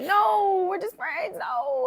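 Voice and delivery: high squeaky no; high mocking refusal. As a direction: brush him off in a high voice. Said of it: "I was like".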